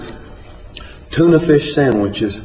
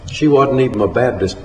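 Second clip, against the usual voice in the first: usual speaking voice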